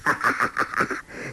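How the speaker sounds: staccato gloating laugh. A narrator's reading. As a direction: rattle out a villain's chuckle. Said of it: "欧阳锋笑道". (连串得意笑声)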